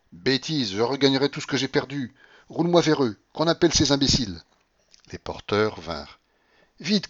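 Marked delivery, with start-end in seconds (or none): 0.1-4.4 louder, higher headstrong orders; dismissive old woman. A narrator's reading. Grandmother ordering porters to wheel her off, dismissive. 6.8-7.1 short, brisk order; commanding. A narrator's reading. Grandmother hurrying the porters, commanding. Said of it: "commanda-t-elle".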